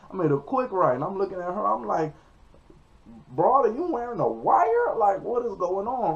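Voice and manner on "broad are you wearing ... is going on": high, loud exasperated questioning; incredulous. Retold or imitated. Driver suspecting his passenger of a wire, incredulous. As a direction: confront them in hammed-up disbelief. Retells confronting passenger in disbelief.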